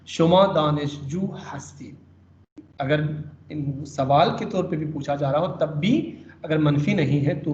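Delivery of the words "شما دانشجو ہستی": slow with دانشجو leaned on; دانشجو stressed, falling at the end. Shown as a demonstration. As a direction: say "شما دانشجو ہستی" slowly, hitting دانشجو. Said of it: "دانشجو پہ ہم stress کریں گے".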